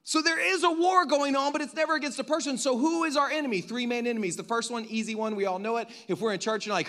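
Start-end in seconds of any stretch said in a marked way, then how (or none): none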